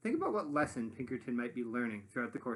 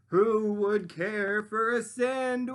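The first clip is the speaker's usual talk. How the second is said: slow, high, louder luring voice; closer, louder call; 7 semitones higher, 9 dB louder, slower than usual. Acted. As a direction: call him closer, drawn out. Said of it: "closer and louder this time".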